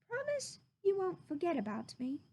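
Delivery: put-on a boy needing reassurance; tender plea. Christopher Robin pleads.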